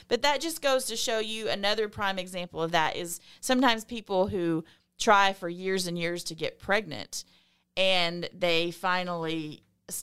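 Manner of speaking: ordinary talk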